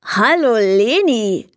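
slow, lightly voiced little goblin; a tiny goblin says hello slowly; goblin greeting. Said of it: "grüßte das Männchen". (Kobold grüßt, langsam)

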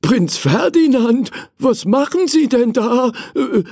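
a mild startled servant's question, high; mildly alarmed at the sight. Acted. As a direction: Hugo finding the prince in the toy box, mildly startled. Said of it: "fragte er erschrocken".

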